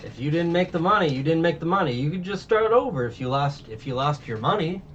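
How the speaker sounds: high, singled-out mock innocence; taunting villain. Arlong tells Nami to start over, taunting.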